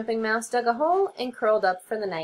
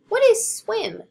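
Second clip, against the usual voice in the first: small high squeaky mouse; high little mouse voice; 11 semitones higher, 6 dB louder than usual. Acted. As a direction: pitch it up like a tiny mouse.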